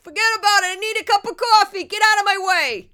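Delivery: loud, high mock New York yell; brash New Yorker. Meek person after six months in New York, mock brash shouting. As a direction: holler like an aggressive New Yorker, hammed up. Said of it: "In six months, you'll be like".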